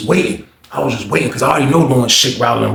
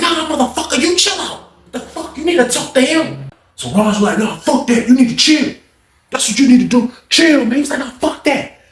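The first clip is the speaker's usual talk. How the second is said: pitched-up cussing [name]; high mock cursing; 10 semitones higher than usual. [name] cursing at Lawrence in a high mock voice. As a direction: snap back in a high put-on voice.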